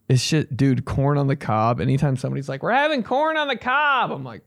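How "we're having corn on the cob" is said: high announcing quote. Acted.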